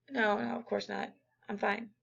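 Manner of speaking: a hint of a student hiding a problem; mock brush-off.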